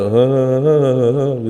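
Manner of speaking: mock quavering drone on one vowel; imitated wobbling recitation. Teacher mimicking a reciter's faults for the class, wobbling drone. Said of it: "itu kalau baca".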